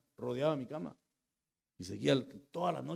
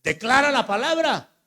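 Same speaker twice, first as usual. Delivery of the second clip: commanding, much higher and louder; voice of the Spirit; 12 semitones higher, 11 dB louder than usual. Acted. The Spirit commanding the sick man in hospital, high and loud. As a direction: proclaim it like a voice from above. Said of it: "Y el Espíritu me dijo".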